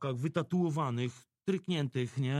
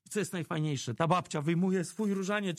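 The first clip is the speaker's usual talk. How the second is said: normal talking voice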